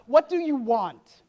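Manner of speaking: loud, slow challenge; emphatic question. Real, not acted. Speaker putting the next question to the audience, loud and emphatic. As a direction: throw the question out loudly, each word weighted.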